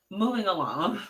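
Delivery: chuckling while moving on; laughing. Real, not acted. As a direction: laugh through it.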